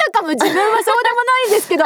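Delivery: fast laughing imitation; laughing mock voice. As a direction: laugh through a teasing impression. Mock voicing the other's pretence.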